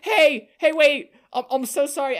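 high, loud yell after a departing cop; yelling after the cop from the car window; retold yell. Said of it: "and I yell".

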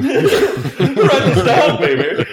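high, very slow and loud through laughter. Retold or imitated. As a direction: stretch it out like a funk star. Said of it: "I'm doing a good [name]".

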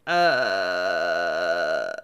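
low creaky vocal fry groan. Acted. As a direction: drag it out in deep vocal fry. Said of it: "Just e-, extreme vocal fry".

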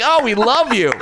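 high-pitched, gushing mock reaction; hammed-up adoring squeal; mock delight. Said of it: "they'll just be like".

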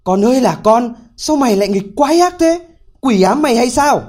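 father berates his foolish son, exasperated; scolding. A narrator's reading. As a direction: chew the son out. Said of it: "mắng con một trận nên thân".

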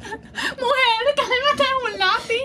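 mock yell at a crybaby over a pencil; exasperated yell. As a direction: snap at a crybaby to calm down. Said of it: "Pero no grites".

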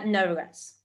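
ordinary talk, nothing marked

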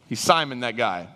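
ordinary talk, nothing marked

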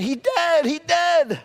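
high, hammed-up lament; mock wail. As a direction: wail it like a gloomy onlooker. [name] mocks onlookers' wail.

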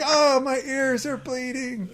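high, slow hammed-up whine; mock pained crowd. Imagined crowd reacting to the podcast, mock pained whine. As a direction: groan as if in pain, jokingly. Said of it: "Everybody's like".

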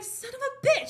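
high-pitched retold cursing; hammed-up indignation; mock exasperated curse. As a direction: curse it high, in comic frustration. Retold curse at stolen soda.

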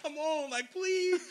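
high, pleading whine to dad; begging. Retold or imitated. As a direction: beg him to stop, voice climbing. Kid begging dad to stop.

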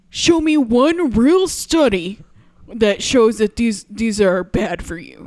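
loud slow skeptical demand; demanding proof, slowly; challenging demand. Retold or imitated. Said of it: "and then he says".

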